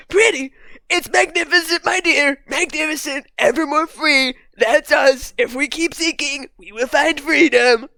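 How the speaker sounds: hammed-up Trump, proclaiming it. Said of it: "I'm gonna read it in a Trump voice".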